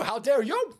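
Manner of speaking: usual speaking voice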